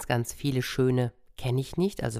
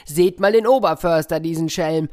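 laughing call; 5 semitones higher, 5 dB louder than usual. Acted. Foresters watching the bear eat, laughing call. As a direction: laugh then loud teasing call. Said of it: "die riefen".

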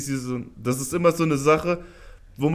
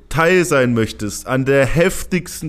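announcer voice; 4 dB louder than usual. Pretend. Announcer-style call for players.

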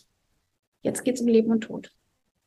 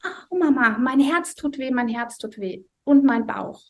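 imitating child's pain cry; 2 semitones higher than usual. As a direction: act out a small boy crying in pain, softened in the retelling. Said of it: "Und dann schrie er plötzlich".

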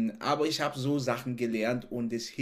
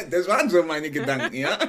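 laughing; 6 dB louder than usual. Real, not acted. Laughing at own dream.

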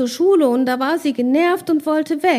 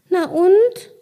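defiant; 8 semitones higher, 5 dB louder than usual. Acted. Child shrugs off Carsten.

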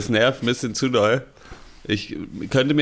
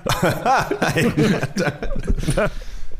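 laugh; 7 semitones higher than usual. Real, not acted. Laughing at the intro.

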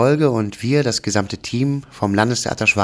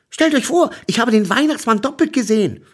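put-on much higher, louder excited child; 11 semitones higher, 3 dB louder than usual. A narrator's reading. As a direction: burst out the news, thrilled. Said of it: "erzählte ich ihnen gleich aufgeregt". (aufgeregt, viel höher und lauter)

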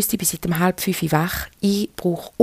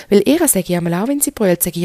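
ordinary talk, nothing marked

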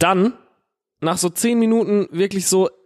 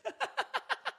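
soft high laugh; 18 dB quieter than usual. Real, not acted. Laughing mid-story.

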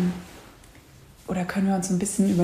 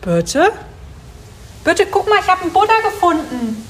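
high, loud call across the room; excited loud calling; 8 semitones higher, 7 dB louder than usual. Acted. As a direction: shout for Birte to come see.

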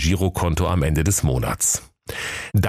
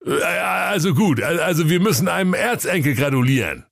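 fumbling retry; 11 semitones higher than usual. Acted. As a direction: stumble through the phrase again. [name] retries the phrase.

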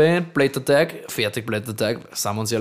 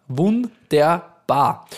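drawn out; 3 semitones higher, much slower than usual. Acted. Drawn-out praise.

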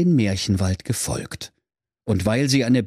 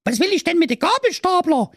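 put-on much higher fast bossy girl voice; little sister. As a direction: nag him like a kid sister. Sister figure.

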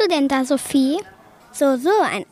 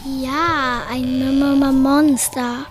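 very slow, stuttered build-up to monster; stuttered suspense; much slower than usual. Acted. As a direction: drag out the reveal for effect. Revealing the monster dramatically.

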